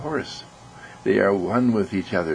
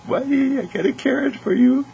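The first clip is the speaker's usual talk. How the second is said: put-on much higher, soft-spoken little child; gentle child voice. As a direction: coax the horse tenderly like a small kid. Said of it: "Just so gentle and so soft".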